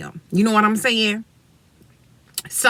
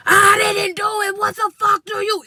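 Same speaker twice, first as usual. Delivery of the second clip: put-on high furious yell of a boy; angry boy yelling; 11 semitones higher, 4 dB louder than usual. Boy cussing at the narrator, angry shout. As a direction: shout it back defiantly.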